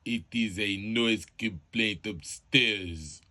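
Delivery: very slow, loud, heavy delivery; slow caller. Acted. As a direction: draw every word out. Caller drags out complaint slowly.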